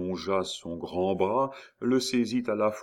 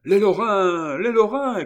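high, loud alarm cry; 12 semitones higher, 11 dB louder than usual. A narrator's reading. Voice amid the tumult warning the crowd, shouted. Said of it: "cria une voix au milieu de ce tumulte".

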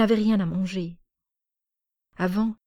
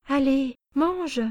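put-on high little girl urging, rising higher; coaxing child; 7 semitones higher, much slower than usual. Small girl urging the uncle to eat, high and insistent.

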